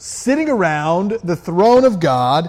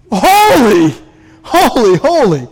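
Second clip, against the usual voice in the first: much higher and louder, breathless wonder; awestruck praise; 4 semitones higher, 7 dB louder than usual. Acted. Creatures before the throne, loud high overwhelmed praise. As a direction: cry out as if dazzled by God.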